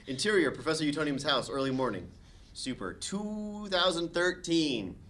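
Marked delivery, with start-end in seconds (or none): none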